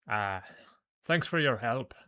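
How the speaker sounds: rough-edged Irish barfly voice; thick Irish brogue. Acted. Irish giant thanking the player for help, in a thick brogue. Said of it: "he says in a thick brogue".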